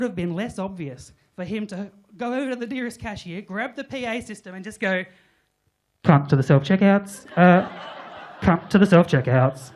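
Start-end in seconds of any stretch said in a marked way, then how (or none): 6.0-9.7 much louder announcement. Retold or imitated. Mock PA call summoning Sharon to the checkouts. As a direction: boom it over the shop speakers. PA system imitation.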